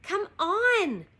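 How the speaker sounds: high-pitched urging call; shouting. A narrator's reading. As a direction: call a companion from a distance. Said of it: "Now Annie was shouting".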